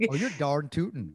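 hammed-up folksy Minnesota twang; mock Minnesota accent. Joking imitation of Minnesota speech.

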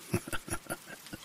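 breathy sinister chuckle; menacing chuckle. A narrator's reading. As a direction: chuckle like a creepy stalker. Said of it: "a low, menacing sound".

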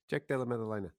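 low, soft, reluctant reveal; suddenly quiet. Acted. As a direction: drop the name under your breath. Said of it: "you went a bit quiet there".

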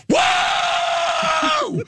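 high mock yell of "Whoa!"; imitating a scream. Man yelling at the TV goal, high mock yell. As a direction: yell it like a hockey fan in an ear. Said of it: "The loudest scream".

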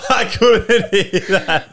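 high, slow words through laughter; laughing. Real, not acted. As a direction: struggle to speak while cracking up. Still laughing at the joke.

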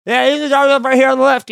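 loud, fast mock-casual through stuffed cheeks; high and loud, hiding the cloves in the gums; talking round a mouthful. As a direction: cheeks full of cloves, forcing a breezy goodbye. Mouth full of cloves, to driver.

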